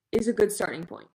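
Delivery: ordinary talk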